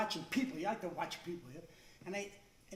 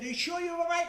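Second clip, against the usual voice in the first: much higher mock-concerned question; mock worried; 8 semitones higher, 5 dB louder than usual. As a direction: ask again in rising mock worry. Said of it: "And I said".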